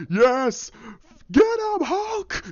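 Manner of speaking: high, strained cheer, imitated; Thor. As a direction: egg on a teammate in a mock yell. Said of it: "you have Thor go".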